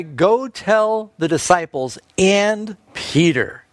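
slow and deliberate, leaning on "tell"; slow down and land every word of "Go tell the disciples and Peter"; slow, emphatic quote. Shown as a demonstration. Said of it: "Notice who he specifically mentions".

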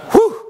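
high, sudden "whew!"; short, loud, high exclamation; exclaimed whew. Acted. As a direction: let out a high-pitched whew of disgust. Reacting to feeding on trash.

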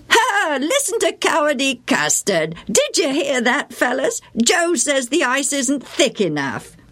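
high, jeering schoolboy taunt; mocking boy. A narrator's reading. Bill mocking Joe in front of the fellas, high and jeering. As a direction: sneer at him like a playground bully. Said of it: "Bill mocked".

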